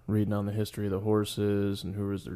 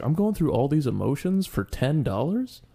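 higher, louder incredulous rant; retold outrage at winning only ten dollars; exasperated disbelief; 4 semitones higher, 4 dB louder than usual. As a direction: vent like the stakes were a joke. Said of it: "I was like".

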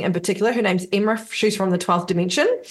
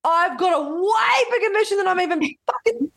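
high-pitched retelling of being blown away; amazed excitement; 11 semitones higher than usual. Retold or imitated. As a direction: re-enact your own astonishment, pitched way up.

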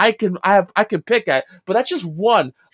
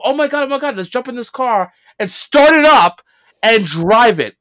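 higher, louder hammed-up panicked quote; mock panic; 4 semitones higher, 5 dB louder than usual. Mocking the movie's Interpol agents, hammed-up panic. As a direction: imitate frantic agents rushing to the car.

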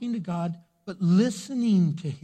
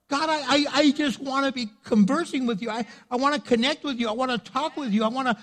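earnest prayer; 8 semitones higher than usual. Acted. Voicing a prayer to God.

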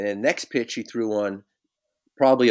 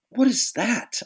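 incredulous protest; 12 semitones higher, slower than usual. Retold or imitated. Batter protesting a bad call.

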